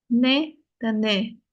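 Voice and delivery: "Ne dan ne" drawn out so the two vowels differ; slow contrast ne and ne. Shown as a demonstration. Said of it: "Yang ini E, yang ini A". ("ne" dan "ne" dibedakan pelan)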